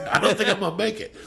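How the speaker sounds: a starstruck fan cracking up; overwhelmed laugh. Retold or imitated. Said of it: "look, I'm getting emotional".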